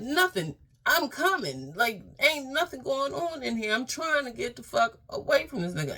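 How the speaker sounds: high, loud flustered answer; high, loud, frantic mock denial; panicked reply. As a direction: sound caught and desperate to get out. Trapped, answering sister.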